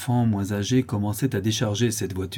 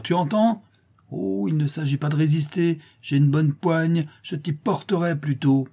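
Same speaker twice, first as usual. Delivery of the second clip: high-pitched, forceful threat; bully her into coming along; threatening; 7 semitones higher than usual. A narrator's reading. Widow ordering the girl to the mayor, threatening.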